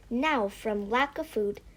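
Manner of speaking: ordinary delivery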